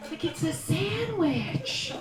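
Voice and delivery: ordinary talk, nothing marked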